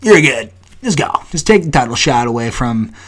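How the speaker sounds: high mocking voice of the UFC brass; squeaky put-on voice, brushing someone aside; mock official. Fighter mocking the UFC's title picks, in a high sarcastic voice. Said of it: "and then you're like".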